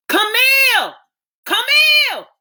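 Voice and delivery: very high, loud calls; mother shouting for her daughter to fetch the doctor; urgent shout. Acted. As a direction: yell down the hall for help. Mother calling for help.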